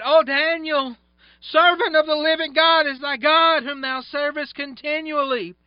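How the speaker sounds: high, loud anguished cry to Daniel; lamentable cry. Acted. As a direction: call out in anguish, voice near cracking. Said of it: "cried with a lamentable voice".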